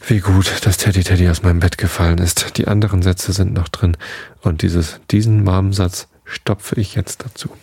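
usual speaking voice

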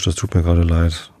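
ordinary delivery

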